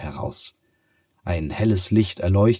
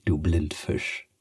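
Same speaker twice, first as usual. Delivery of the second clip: slow, lower teasing of du Blindfisch; teasing cat; 3 semitones lower, slower than usual. Acted. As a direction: drawl du Blindfisch at her. Cat teases Sophia. (langsam und tiefer neckend: du Blindfisch)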